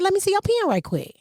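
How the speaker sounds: high-pitched request with PEN as "pee-in"; country pen. Retold or imitated. Host repeating her request to coworker, high, PEN as "pee-in". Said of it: "It was country pen".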